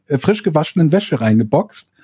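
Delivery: normal talking voice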